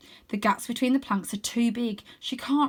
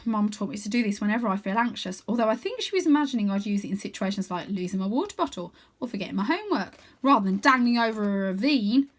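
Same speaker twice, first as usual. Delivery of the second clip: plain reading voice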